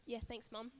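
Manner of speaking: quick whispered thanks aside; hushed unvoiced aside. Real, not acted. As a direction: whisper it off-mic to Mum.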